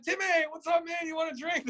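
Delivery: high mock-excited bar shout; playing along. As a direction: play along like his biggest pal. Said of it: "I'm playing, I'm going along with it".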